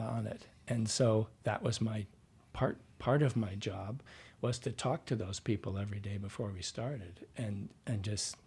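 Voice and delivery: normal talking voice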